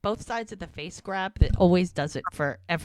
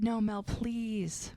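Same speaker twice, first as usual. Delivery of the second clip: slow, higher acted plea; near-tearful pleading; 4 semitones higher, 4 dB quieter, much slower than usual. Man begging his ex not to leave, mock tearful. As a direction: draw it out, imitating a man begging. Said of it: "he's, like, practically crying".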